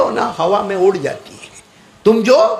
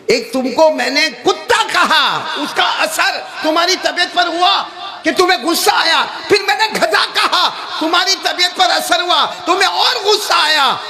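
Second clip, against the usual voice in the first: high shouted argument; triumphant point-making; 10 semitones higher than usual. Retold or imitated. The Maulana proving his point to the hakim, high shouted argument.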